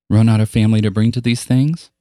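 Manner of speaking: mocking taunt. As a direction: needle him in front of everyone. Popular girl taunting Marcus.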